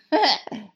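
loud, high burst of laughter; short laugh. Acted. Hatter amused at his own riddle, short laugh. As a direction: laugh briefly and loudly.

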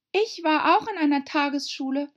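put-on high, loud girlish voice; young Alice retorting. (hohe, laute Alice-Stimme)

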